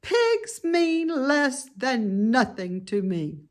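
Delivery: high, dismissive lamb voice; haughty lamb. Acted. Lamb snubbing Wilbur, high and dismissive. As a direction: look down your nose at the pig.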